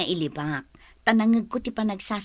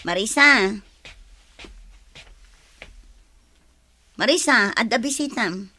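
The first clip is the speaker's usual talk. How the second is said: calling out. Acted. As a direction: call her name across the house. Calling daughter from another room.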